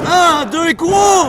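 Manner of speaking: loud, slow shouted count; excited shout. Real, not acted. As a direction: shout the pin count to the crowd.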